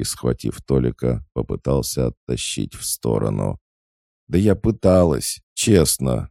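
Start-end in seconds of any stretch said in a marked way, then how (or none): 4.3-6.3 high, loud self-justifying plea; distressed protest. A narrator's reading. Pinned girl defends herself to Volodya, distressed and protesting. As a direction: protest that you really tried. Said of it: "выдохнула Сонька".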